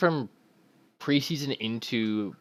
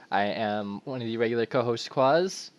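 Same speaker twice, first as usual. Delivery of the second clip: ordinary delivery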